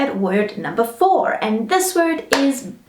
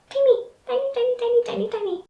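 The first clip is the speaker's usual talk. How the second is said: very high, tiny voice that shrinks to almost nothing; tiny squeak; 12 semitones higher, 4 dB quieter, much faster than usual. Pretend. Teacher squeaking to show something is tiny. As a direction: shrink the voice to show how small it is.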